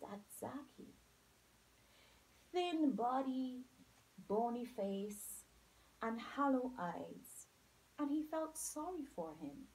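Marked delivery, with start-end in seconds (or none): none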